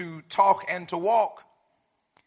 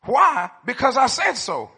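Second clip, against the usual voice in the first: loud, higher mock dad retort; 5 semitones higher, 6 dB louder than usual. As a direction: snap back like a strict father. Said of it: "I heard this one a lot".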